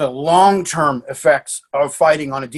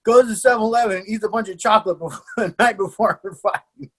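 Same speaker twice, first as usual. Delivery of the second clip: higher-pitched, breaking into laughs; real laughing; 5 semitones higher than usual. Real, not acted. Coach recounting the night before her fight, laughing. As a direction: tell it through real laughter. Laughing at own funny story.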